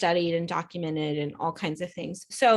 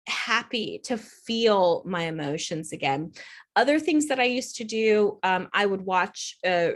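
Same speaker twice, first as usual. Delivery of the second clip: ordinary talk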